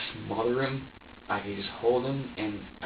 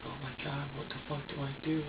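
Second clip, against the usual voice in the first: hushed panic; 2 semitones higher, 11 dB quieter than usual. Retold or imitated. Panicked quote while baby cries.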